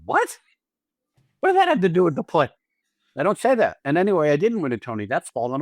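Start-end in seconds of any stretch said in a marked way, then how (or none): 0.1-2.5 baffled. Retold or imitated. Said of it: "You'd be like".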